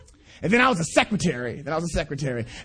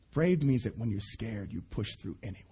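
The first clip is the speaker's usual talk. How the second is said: put-on soft child's answer; 11 semitones lower, 10 dB quieter than usual. Son's quoted answer, very soft.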